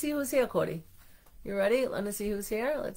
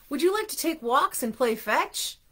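a touch of excitement, pitched up; excited question; 6 semitones higher than usual. A narrator's reading. Mister Bing inviting Arnie to play, mildly excited asking. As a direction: ask it eagerly with a higher, lifted voice. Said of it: "asked excitedly".

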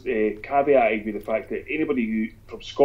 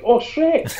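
sudden high delighted yelp; light up high at the name; imitating an American's sudden delight; mock recognition; 3 dB louder than usual.

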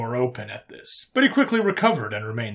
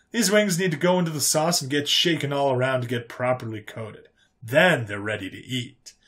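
folksy human character; 3 semitones higher than usual. Acted. Human showing off his special sauce, folksy. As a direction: savor it like a proud home cook.

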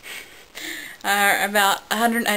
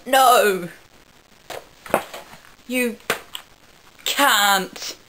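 loud, drawn-out mock yell; 2 semitones higher, 4 dB louder, much slower than usual. Captain Jack yelling at the narrator on seeing her, mock yell. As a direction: yell it out in separated bursts. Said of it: "Captain Jack obviously saw me and yelled".

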